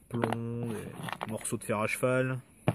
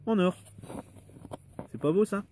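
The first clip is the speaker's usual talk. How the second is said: high, loud burst of delight; proud delight; 9 semitones higher, 5 dB louder than usual. Real, not acted. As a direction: show off the gold find, voice leaping up. Shows off the gold coin.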